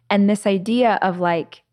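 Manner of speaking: usual speaking voice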